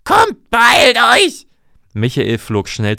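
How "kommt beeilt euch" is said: loud, high, slow drawn-out rallying shout; calling the crowd to run; urgent call. Acted. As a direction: hurry the villagers along at full voice. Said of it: "rief sie".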